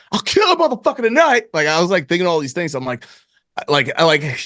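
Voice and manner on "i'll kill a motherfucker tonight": much higher, put-on robber voice; hammed-up menace, pitched up; mock tough threat. Wondering what to say in the store, mock threatening.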